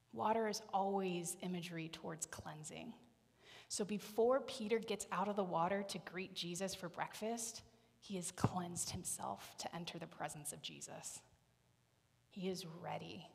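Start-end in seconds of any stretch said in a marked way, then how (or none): none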